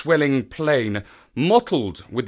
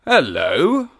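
startled cry; 5 semitones higher, 4 dB louder than usual. Acted. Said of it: "I cried".

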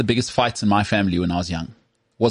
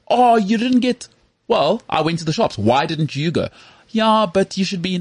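high, whiny nagging parent voice; mock a complaining mother; nagging mom; 8 semitones higher, slower than usual. Mocking mom's shopping complaints.

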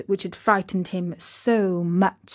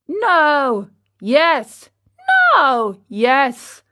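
louder, emphatic one-word retorts; heated bickering; 5 semitones higher, 6 dB louder, much slower than usual. Acted. Two old men contradicting each other, bickering. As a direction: throw each word back in acted indignation.

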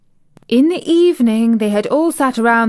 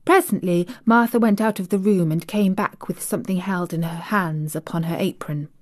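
ordinary delivery

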